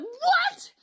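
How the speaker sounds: very high, piercing what; shocked shriek. Retold or imitated. Shocked woman to her date at the snap trap, shrieking. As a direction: squeal it in panicked disbelief.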